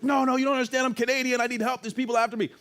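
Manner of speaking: high, pleading cry for help; desperate begging. Retold or imitated. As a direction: beg the police desperately. Begging the police.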